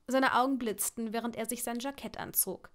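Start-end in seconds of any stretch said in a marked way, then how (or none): none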